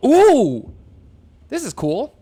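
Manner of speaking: high loud ooh of delight; real pleased surprise. Real, not acted. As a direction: gasp at something cool. Reacts to new scene.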